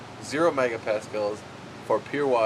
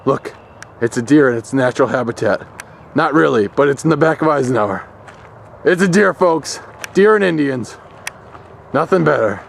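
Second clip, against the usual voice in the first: nature documentary; 7 dB louder than usual. Pretend. Mock wildlife film narration, deer outside.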